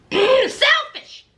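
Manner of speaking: imitating a shouting wife; mock shout. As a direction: wail it out like a wronged wife. Wife raging at husband.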